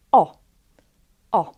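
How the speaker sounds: "o" as a short pure vowel, no nasal; plain o. Shown as a demonstration. Teacher shows the simplified letter, plain o. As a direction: drop all nasality from "o". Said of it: "sometimes we pronounce on".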